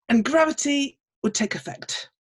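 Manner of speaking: ordinary delivery, nothing marked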